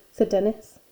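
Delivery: plain reading voice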